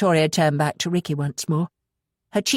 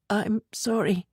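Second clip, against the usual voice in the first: croaked; 5 semitones higher, slower than usual. Acted. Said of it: "she croaked".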